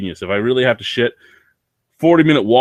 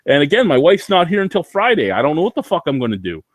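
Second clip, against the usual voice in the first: usual speaking voice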